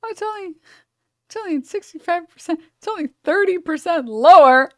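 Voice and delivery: high, sarcastic sing-song; mocking. To the chat, a sarcastic high mock of the claim. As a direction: poke fun at the "only" spin.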